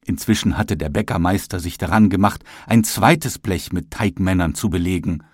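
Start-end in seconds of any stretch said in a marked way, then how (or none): none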